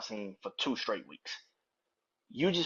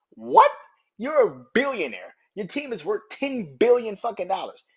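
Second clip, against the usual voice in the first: high yelped question into indignant, raised-pitch protest; incredulous outrage; 8 semitones higher, 5 dB louder than usual. Acted. Fan answering a billionaire's claim he couldn't pay, incredulous outrage. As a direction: throw the excuse back in disbelief. Incredulous retort to absent owner.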